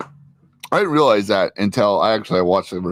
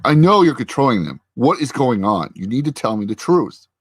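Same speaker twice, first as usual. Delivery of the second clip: retold angry confrontation, higher and louder at first; getting pissed; 3 semitones higher, 3 dB louder than usual. Recapping a husband confronting his wife, getting pissed. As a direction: press the accusation hard, then ease slightly. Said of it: "And he's getting pissed".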